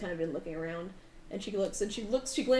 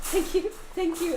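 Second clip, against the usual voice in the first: grateful mother; 8 semitones higher, 4 dB louder than usual. Acted.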